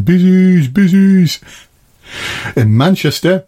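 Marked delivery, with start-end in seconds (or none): 0.0-1.4 high, loud mocking call of busies; imitated Scouse street shout. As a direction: yell it like kids jeering at a passing police car. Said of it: "you'd hear people shouting".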